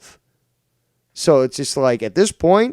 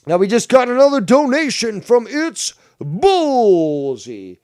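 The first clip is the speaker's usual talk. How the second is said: loud, high hype announcement; showman announcer; 9 semitones higher, 6 dB louder than usual. Real, not acted. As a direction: announce it big, stretching the name.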